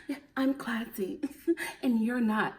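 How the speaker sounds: soft, lightly haughty mock voice, laughing in between; mocking Courtney. Vlogger imitating Courtney's smug classy line, soft and mocking. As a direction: lightly send up her smug tone. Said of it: "mock of Courtney's line".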